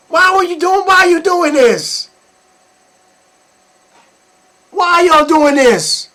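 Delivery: high, loud demanding why; outraged questions to parents. Real, not acted. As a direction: demand an answer.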